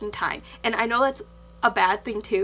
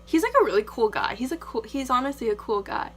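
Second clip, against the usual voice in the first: ordinary talk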